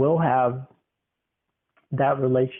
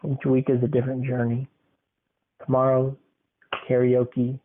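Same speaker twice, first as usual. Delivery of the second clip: ordinary talk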